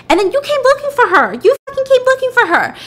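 very high, loud indignant yell; shrieked accusation. Retold or imitated. Woman accusing the husband before police, high loud yell. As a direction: fling the accusation out at top pitch.

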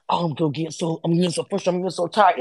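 low, quiet mock-weary complaint; mock emotional. Mocking a housemate's frustration, low and emotional. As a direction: imitate her worn-down grumbling, softly. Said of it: "she's so emotional".

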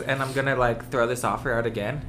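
ordinary talk

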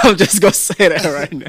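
laughing while talking. Real, not acted. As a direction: laugh through the words. Laughing after admitting he's high.

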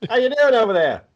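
high-pitched put-on Cockney; Cockney accent. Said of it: "our D M did a Cockney accent".

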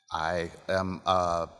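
ordinary talk, nothing marked

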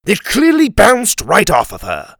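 high, loud, mocking retort; scornful, exasperated king; sarcastic. A narrator's reading. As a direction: sneer the obvious at him sarcastically. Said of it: "the king said sarcastically".

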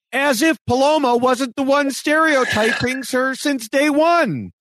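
high-pitched indignant disbelief; incredulous. Real, not acted. Co-host calling out Paloma's irony, incredulous. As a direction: pitch it way up, aghast at the hypocrisy.

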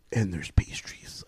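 slow, hushed whisper; whispered reveal. Retold or imitated. Friend pulling pastries from big bag, whispered reveal. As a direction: whisper it slowly like a secret.

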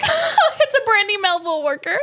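high, drawn-out laughing jeer; mock gawking. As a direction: imitate customers pointing and laughing. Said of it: "walked in and were like".

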